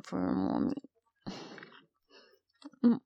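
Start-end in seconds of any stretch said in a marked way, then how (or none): none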